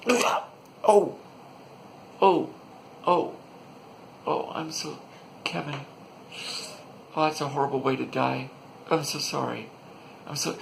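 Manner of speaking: high, hammed-up sorrowful moaning; milk the mock grief; lightly squeaky mourner. Soldier grieving another victim.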